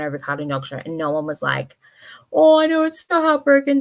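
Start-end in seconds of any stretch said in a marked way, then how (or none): 2.3-3.8 high, loud mock commiseration; gushing sympathy. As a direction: imitate gushing fans, louder and higher. Acting out followers' gushing sympathy.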